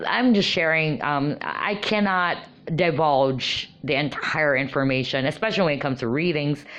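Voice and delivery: normal talking voice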